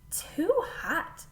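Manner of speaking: breathy little whisper of too hot; whispered cry. A narrator's reading. Girl tasting soup, whispered. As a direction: hush it out like a startled girl. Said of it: "she cried".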